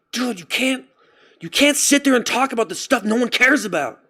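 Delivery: mock angry self-lecture; mad at himself. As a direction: berate yourself while pacing. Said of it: "mad at myself".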